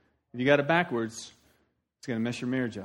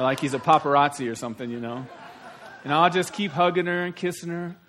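ordinary delivery

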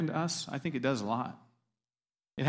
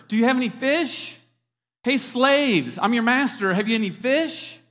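loud, higher-pitched mock boss; brusque, hammed-up hollering at the workers; bossy summons; 6 semitones higher, 5 dB louder than usual. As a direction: bark it like a master at underlings. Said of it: "he doesn't come to them and say".

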